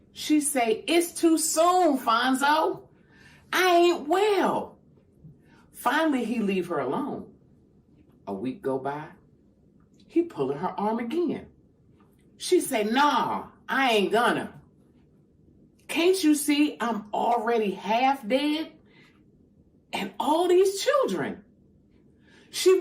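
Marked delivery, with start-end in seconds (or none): 0.9-4.8 high, drawn-out plea of an unwell woman; pleading wail in a dramatic monologue; acted pleading. Woman acted pleading with her husband. 12.8-21.4 high, exasperated refusal; worn-out woman protesting, voice raised high; acted protest. Woman acted refusing her husband again.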